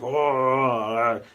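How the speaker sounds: long, loud mock roar of rage; mock angry roar. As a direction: bellow a drawn-out "oh", imitating someone mad. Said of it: "Instead of being all like".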